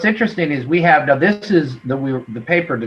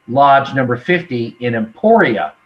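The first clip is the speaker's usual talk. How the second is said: ordinary delivery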